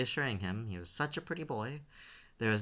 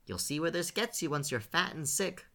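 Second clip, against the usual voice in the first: scornful; 8 semitones higher, 4 dB louder than usual. A narrator's reading. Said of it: "Gerda snorted scornfully".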